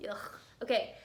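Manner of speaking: usual speaking voice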